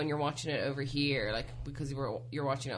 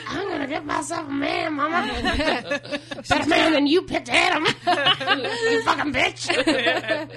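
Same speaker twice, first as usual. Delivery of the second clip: higher, louder mocking voice, laughing; 10 semitones higher, 7 dB louder, slower than usual. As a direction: ham up a bratty girl through laughter. Mocking a reality show girl.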